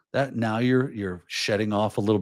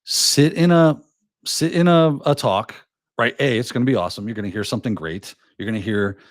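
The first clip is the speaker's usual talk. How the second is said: ordinary talk, nothing marked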